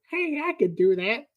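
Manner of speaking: high-pitched mock impression; terrible George Lucas. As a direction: put on a bad, high celebrity voice. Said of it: "It's a terrible George Lucas".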